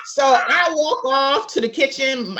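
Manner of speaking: ordinary talk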